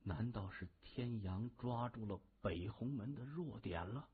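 very soft inner musing; thinking to himself. A narrator's reading. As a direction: wonder privately, under the breath. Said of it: "他暗说". (低声暗自琢磨)

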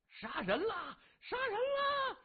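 high-pitched cry of alarm, drawn out; panicked shouting. A narrator's reading. Villagers seeing blood cry out in alarm, high drawn-out shouting. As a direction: cry out murder in a high wail. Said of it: "顿时纷纷大叫".

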